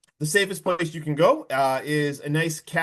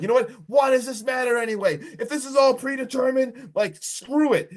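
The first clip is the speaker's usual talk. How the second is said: higher-pitched retold outburst; mock exasperation, pitched up; losing it; 7 semitones higher than usual. Carter to his friends in the car, retold as losing it. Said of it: "He is losing it".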